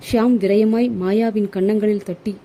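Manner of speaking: ordinary delivery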